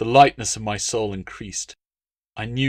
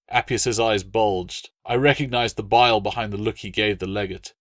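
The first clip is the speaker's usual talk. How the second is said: plain reading voice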